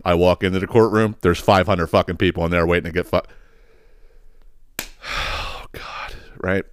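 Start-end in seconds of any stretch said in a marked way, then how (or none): 4.8-6.6 softer, whispered groan; weary whisper. Real, not acted. Seeing the packed courtroom, a soft whispered groan. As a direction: breathe it out, worn out.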